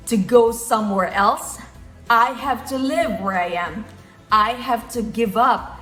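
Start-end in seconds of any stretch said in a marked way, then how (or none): none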